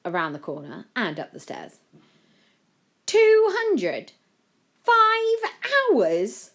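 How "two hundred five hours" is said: high, loud, incredulous outburst; appalled disbelief. A narrator's reading. Joe hears how many calls await, aghast. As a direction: blurt each figure in shock. Boy appalled at the call count.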